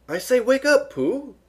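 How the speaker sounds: high, loud wake-up call. A narrator's reading. Piglet waking Pooh from a happy dream, calling high.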